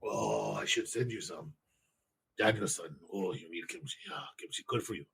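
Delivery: soft sage giving advice; mock wise old master. Mock wise teacher voice, Daniel-san.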